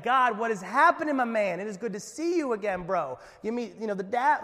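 loud, overly chummy bro talk to God; hammed-up buddy greeting, zero reverence; mock casual greeting.

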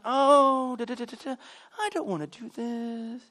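high, soft, dragged-out whine; hammed-up reluctant moan; mock whining.

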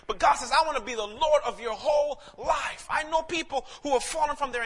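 loud, high, driving sermon voice; full-force preaching. Acted. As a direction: preach it out to the whole hall.

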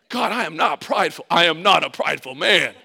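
loud, hammed-up self-defence; indignant protest. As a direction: protest the charge at full volume, mock-outraged. Protesting God's charge of pride.

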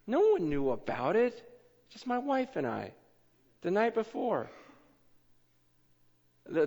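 usual speaking voice

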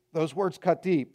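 ordinary talk, nothing marked

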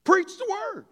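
shouted, high, urgent order; loud, strident imperative; serious command. Acted. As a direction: yell it as a do-it-now command. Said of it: "I think he was serious".

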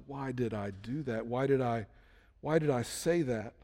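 low, soft self-reproach; quiet regret. Acted. Someone saying to themselves years later, quiet regret. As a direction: murmur it to yourself with regret.

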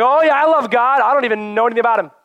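loud, high, fast gushing zeal; hammed-up giddy believer; gushing enthusiast. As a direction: bubble over like a clueless fan of God. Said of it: "You're gonna just be like".

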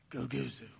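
low, soft discontented murmur; murmured grumble. Shown as a demonstration. Preacher sounding out the Greek word for grumble, murmuring. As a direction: drop it low like a muttered complaint. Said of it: "It means to murmur".

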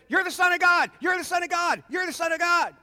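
high, insistent repeated shout; frantic high-pitched proclaiming; demon shouting. Acted. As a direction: cry it out over and over.

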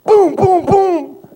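loud high booms through a laugh; mock thunder booms. Mimicking the thunder.

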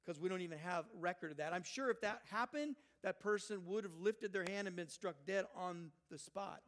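ordinary talk, nothing marked

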